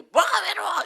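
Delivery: scornful retort. Retold or imitated. Pastor mimics a dismissive person yelling back. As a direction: shout down someone who says they're lonely. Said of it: "뭐 이런 사람이 있어요".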